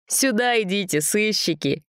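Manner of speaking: high merry call; cheerful calling. A narrator's reading. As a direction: call the kids playfully. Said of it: "весело позвала бабушка".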